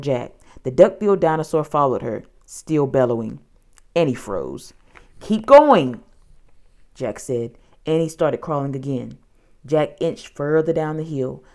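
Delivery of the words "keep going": loud, high urgent command; insistent raised-voice urging. A narrator's reading. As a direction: push her to keep moving. Jack urges frozen Annie on.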